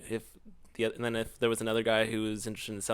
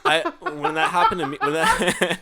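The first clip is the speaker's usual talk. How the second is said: laughing; 6 dB louder, slower than usual. Real, not acted. Laughing at partner's story.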